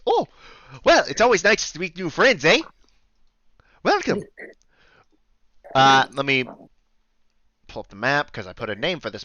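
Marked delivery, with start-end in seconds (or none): 0.1-4.2 high, welcoming Canadian innkeeper; Canadian accent. Acted. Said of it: "deal with the accent".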